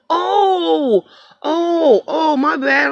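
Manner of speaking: high mock apology; sarcastic oh my bad. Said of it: "I'm like".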